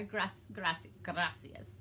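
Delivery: stumbling tries at a rolled r in "gracias"; attempted rolled R. Shown as a demonstration. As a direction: restart "gracias", attempting to trill the r. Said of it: "I tried to roll my R".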